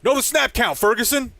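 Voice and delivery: loud, high shout from the crowd; imitated heckler. Imitating fan to Jake Ferguson after offside, loud scolding yell. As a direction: yell it at a player who messed up. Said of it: "I heard a".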